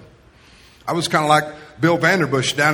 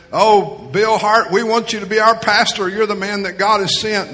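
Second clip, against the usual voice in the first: eager plea; 6 semitones higher, 5 dB louder than usual. Retold or imitated. Church group begging Bill Hart to be pastor, eager. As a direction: beg him eagerly to stay. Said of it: "eagerly came up to me".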